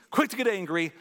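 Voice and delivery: ordinary talk, nothing marked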